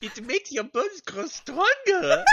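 high, loud mock-French squeal; silly French accent. Teasing a friend about French, high hammed-up French accent. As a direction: pitch it high in cartoonish French. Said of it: "why am I French now?".

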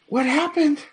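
high, loud worried healer. Acted. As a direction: cry it out high with worry. GM voices healer Rena.

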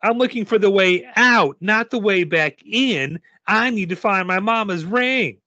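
put-on high, emphatic child's voice; high-pitched girl character, urgent; insistent little girl. A narrator's reading. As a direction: press the point like an exasperated kid.

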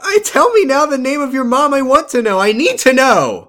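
shouting high and loud in mock fury; hammed-up furious demand; mock outraged yell. Mock outraged yelling at show.

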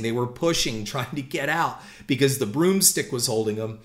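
ordinary talk

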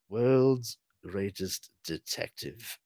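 low, hushed, deadpan; very quiet deadpan butler; dry butler aside. Acted. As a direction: drop it like a sardonic butler. Sarcastic Alfred-style aside.

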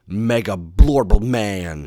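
loud, drawn-out cartoon villain cry. A narrator's reading. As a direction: bellow it slowly as a gurgling underwater villain. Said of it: "he cried".

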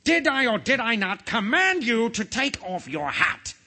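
high, indignant royal roar; roaring king. A narrator's reading. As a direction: thunder the question at a disobedient subject. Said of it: "roared the king again".

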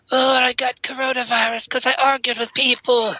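high, wailing spook; ghost voice. Pretend. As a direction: moan it out in mock lament. Ghost explaining its death.

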